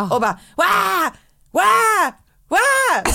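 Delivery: high, loud mock baby wail; three drawn-out imitated baby cries; imitating crying baby. Mum showing friend how upset babies sound, loud mock wail. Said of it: "Så låter de ju".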